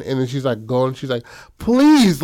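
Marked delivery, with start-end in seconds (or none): 1.6-2.2 louder, high-pitched acted plea; desperate pleading. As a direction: beg harder, voice leaping up. Begging escalates in retold scene.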